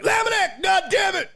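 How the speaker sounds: imitating a coach screaming at a player; coach yelling. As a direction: holler the player's name across the field. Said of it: "My coach was like".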